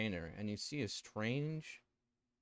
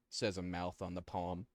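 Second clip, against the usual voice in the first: ordinary talk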